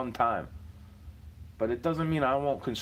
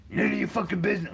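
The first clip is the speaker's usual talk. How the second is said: mock-angry reply to a kind question; imitating angry brush-off; 6 semitones higher, faster than usual. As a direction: throw it back like a sulky stranger. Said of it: "Let me go be angry".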